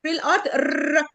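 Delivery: ر rolled as a full trill; trilled Arabic ر. Shown as a demonstration. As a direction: roll the ر hard like Arabic. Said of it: "في العربي عندنا تريل R".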